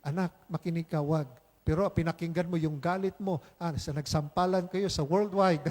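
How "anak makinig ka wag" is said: gentle Spirit voice. Retold or imitated. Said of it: "sabi ng Espiritu".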